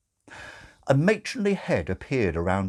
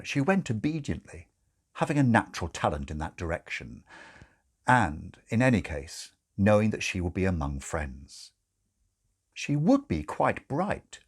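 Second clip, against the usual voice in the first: ordinary delivery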